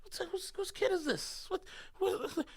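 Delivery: put-on high, soft sing-to-a-toddler voice; cooing to a lost child. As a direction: coax a little lost kid, high and gentle. Imagined adults cooing to lost child.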